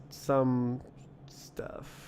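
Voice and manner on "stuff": whispered. Real, not acted. Trailing off while reading docs.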